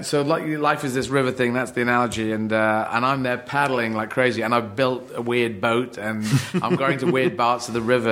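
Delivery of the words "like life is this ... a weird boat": ordinary talk, nothing marked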